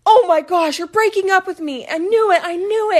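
put-on high, loud wailing boyfriend voice; hysterical boyfriend. Teller imitating the boyfriend at the airport, high loud wail. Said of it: "And he's like".

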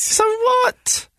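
very high-pitched comic protest; mock-defensive retort. Man teased by his co-host about weight, mock-defensive. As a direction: squeal it back in playful defence. Defending himself in teasing exchange.

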